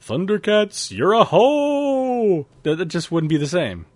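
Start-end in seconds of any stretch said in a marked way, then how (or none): 0.0-2.5 high and stretched out, way above normal, louder; sing-song cartoon intro parody; mock theme-song voice. Host spoofs the Thundercats opening, mock singing high. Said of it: "What if the original Thundercats was".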